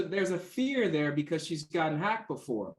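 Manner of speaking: normal talking voice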